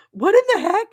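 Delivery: high, loud, disbelieving cry; incredulous outcry. Real, not acted. Vlogger ranting to viewers about freak weather, incredulous high outcry. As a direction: throw up your hands at the weather.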